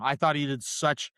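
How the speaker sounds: ordinary talk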